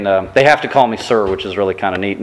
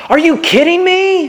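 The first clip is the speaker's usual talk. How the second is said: put-on much higher, small child's exasperated squeal; toddler imitation; 3 dB louder than usual. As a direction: play the three-year-old echoing dad. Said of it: "our three-year-old runs around the house going".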